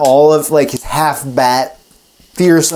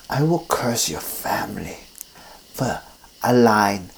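whispered, softer vampire curse; 4 semitones lower, 9 dB quieter than usual. Acted. Dracula curses the physician's family in a whisper. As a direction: breathe the curse like a spell. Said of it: "And he goes".

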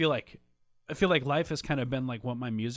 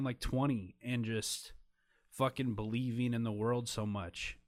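getting emotional; 5 dB quieter than usual. Real, not acted. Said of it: "I'm gonna get emotional".

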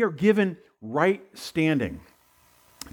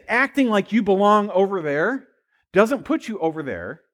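ordinary talk, nothing marked

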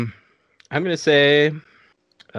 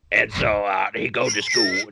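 normal talking voice

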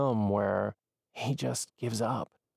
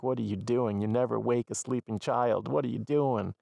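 ordinary talk

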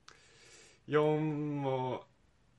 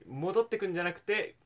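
normal talking voice

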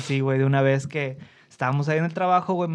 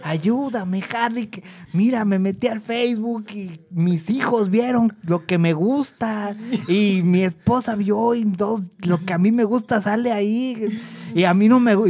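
put-on higher, slower worried old man; imitating anxious older coworker; 4 semitones higher, slower than usual. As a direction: explain the problem fretfully, like a panicked señor. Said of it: "tengo que imitar su voz". (voz de señor preocupado, más aguda y lenta)

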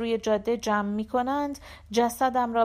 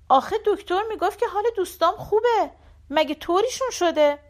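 much higher, fast and louder, urgent questioning; anxious pressing; 10 semitones higher, 5 dB louder than usual. Acted. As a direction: blurt it out, worried and insistent. Anxious son presses mother.

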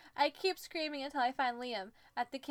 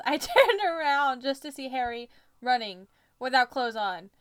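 usual speaking voice

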